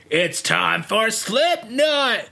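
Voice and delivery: drawn-out, high-pitched announcer call; hyped stage introduction. Retold or imitated. Comedians announce the band to a crowd, mock showman call. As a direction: imitate a showman announcing the band, stretching each word.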